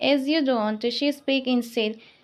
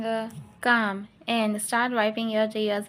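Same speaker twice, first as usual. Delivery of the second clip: ordinary delivery, nothing marked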